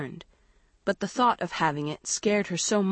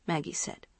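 ordinary delivery, nothing marked

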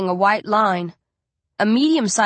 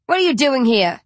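loud sharp demand; snapping; 5 semitones higher, 5 dB louder than usual. Acted. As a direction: snap it in his face. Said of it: "I snap".

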